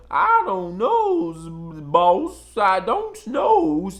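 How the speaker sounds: mock minstrel voice. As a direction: play the cringing minstrel act for laughs. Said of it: "our people were minstrel shows".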